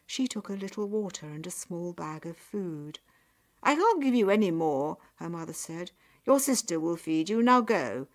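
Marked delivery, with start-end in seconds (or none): 3.6-5.0 loud, grudging refusal; cross raised-voice brush-off; dismissive mother. A narrator's reading. Mother dismisses daughter leaving. 6.3-8.1 loud, curt send-off; stern raised-voice dismissal; dismissive mother. A narrator's reading. Mother dismisses daughter leaving.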